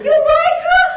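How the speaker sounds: high, shrill-pitched gossip, stretched out; Long Island sophomore. Retold or imitated. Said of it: "my impression of two Boston University".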